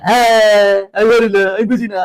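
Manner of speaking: long loud wail, then a whine; hammed-up whining of a baffled student; mock helpless student. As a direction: imitate a student wailing in confusion. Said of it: "এরকমটা হয়ে যাও".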